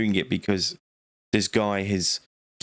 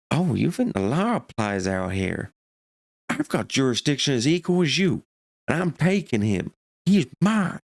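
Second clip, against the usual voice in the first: escalating psychopath rant; 5 semitones higher than usual. Retold or imitated. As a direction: climb from cold threat to a shrieking claim. Screaming match with sheriff.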